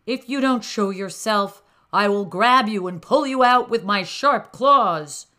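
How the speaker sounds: loud threatening yell. A narrator's reading. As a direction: bellow it into the hole. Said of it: "yelled into the hole".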